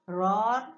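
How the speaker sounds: loud roaring lion. Shown as a demonstration. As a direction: roar like a big cat. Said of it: "Lion or tiger".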